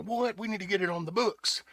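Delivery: high-pitched indignant mock reaction; imitating outraged disbelief. Imagined outraged rally for a law.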